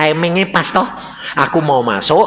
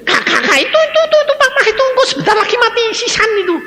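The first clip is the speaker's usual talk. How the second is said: high, fast, stammering alarm; mock panic; 6 dB louder, faster than usual. Younger siblings mobbing big brother about papa, mock-panicked. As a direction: gabble it in a hammed-up high panic.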